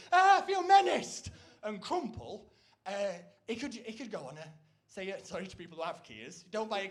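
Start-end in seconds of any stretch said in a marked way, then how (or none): 0.1-1.3 much higher, louder, slow whimper of fear; timid shopper. Retold or imitated. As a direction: crumple in mock terror. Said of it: "they go".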